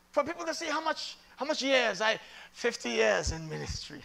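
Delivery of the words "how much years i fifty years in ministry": ordinary talk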